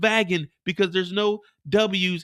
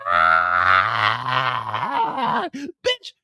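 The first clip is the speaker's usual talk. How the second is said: the hitman run over once more; dying whisper; 4 semitones lower, 4 dB louder, much slower than usual. Acted. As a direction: fade to a wheeze.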